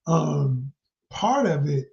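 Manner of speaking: usual speaking voice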